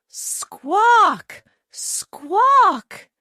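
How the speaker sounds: high, loud hen squawks, twice; frightened squawking. A narrator's reading. Chicken Little, frightened by petals, squawks high and loud. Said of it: "cried Chicken Little, frightened".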